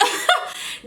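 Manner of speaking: a short airless laugh of disbelief; amused laugh. Real, not acted. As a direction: laugh it off, can't believe it. Laughs at neighbor's request.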